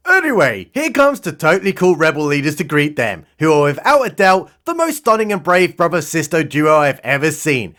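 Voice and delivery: ordinary talk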